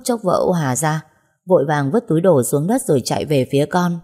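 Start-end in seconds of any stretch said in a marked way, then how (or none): none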